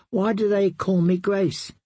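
put-on confused little girl asking, a touch forlorn; lightly voiced plaintive young princess. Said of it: "she asked in a plaintive voice".

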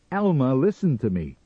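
high, loud insistence; urgent demand. A narrator's reading. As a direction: demand that she listen. Sam urgently pleads with wife.

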